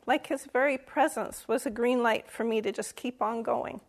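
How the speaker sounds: normal talking voice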